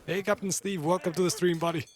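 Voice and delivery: ordinary talk, nothing marked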